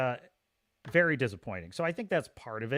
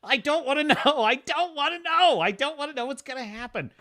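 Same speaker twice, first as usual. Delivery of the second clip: high, loud mock frustration, laughing through it; imitating frustrated whine; 8 semitones higher, 4 dB louder than usual. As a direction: whine that you don't want spoilers. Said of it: "it pissed me off".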